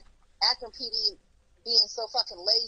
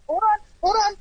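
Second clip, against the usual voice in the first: louder, high-pitched cut-in; insistent, raised-voice interruption; urgent interjection; 8 semitones higher, 5 dB louder, much faster than usual. Real, not acted. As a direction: break in to halt the argument. Cuts in to stop the other.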